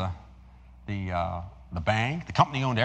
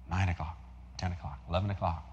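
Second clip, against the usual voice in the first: ordinary delivery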